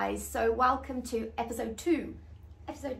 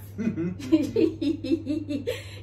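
短促开心的笑声; 被自己的比喻逗笑; laugh; 3 semitones higher, 5 dB louder than usual. Real, not acted. Laughs at own joke.

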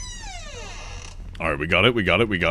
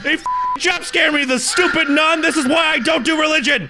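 angry shouting; 11 semitones higher than usual. Real, not acted. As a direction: yell at the nun in outrage. Ranting after being jump scared.

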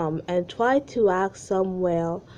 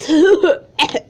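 laughing; 9 semitones higher, 11 dB louder than usual. Real, not acted. Laughs at own reading.

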